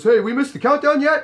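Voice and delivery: high, loud imitation of a customer; eager customer. Customer asking the doorman about the countdown, mock eager. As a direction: call it out high, mimicking a hopeful guy. Voices the arriving customer's question.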